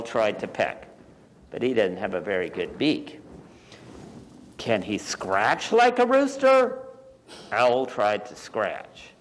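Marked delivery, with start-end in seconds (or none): 4.6-6.8 high-pitched demanding hen; storybook hen's pointed question; bossy hen. Acted. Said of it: "said one bossy hen".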